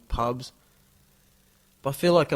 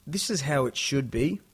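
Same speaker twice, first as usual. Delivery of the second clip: normal talking voice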